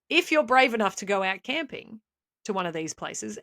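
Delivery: ordinary talk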